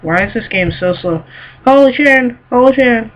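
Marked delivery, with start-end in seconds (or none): 1.6-3.1 high, loud shocked cry; excited exclamation. Real, not acted. Gamer yelling at a sudden fall in the level. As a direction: yelp it out in surprise.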